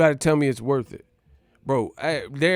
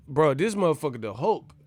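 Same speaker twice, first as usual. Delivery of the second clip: normal talking voice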